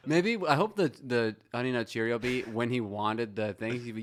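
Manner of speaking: ordinary talk, nothing marked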